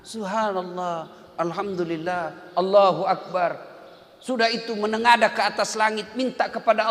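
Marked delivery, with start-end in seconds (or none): 0.0-3.7 slow, unhurried tasbih; slow dhikr. Shown as a demonstration. Preacher showing dhikr after prayer. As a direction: recite each phrase slowly. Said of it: "tinggallah sejenak".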